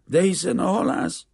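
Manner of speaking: high, loud, bewildered objection; stunned protest. A narrator's reading. Greek father reminding the banker of the fisherman, stunned. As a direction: protest in astonishment, pitch jumping up. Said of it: "dadogta elképedve".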